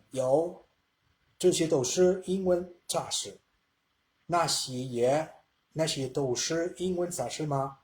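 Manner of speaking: slow, careful Mandarin reading with each tone shaped. Shown as a demonstration. Teacher reads Chinese sentence to class, slow careful tones. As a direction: read 这些都是英文杂志 slowly, tone by tone, like a learner. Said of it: "lo estoy leyendo así porque ya tengo los tonos".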